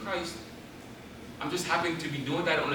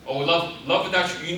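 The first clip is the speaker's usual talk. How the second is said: normal talking voice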